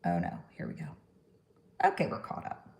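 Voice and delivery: ordinary talk, nothing marked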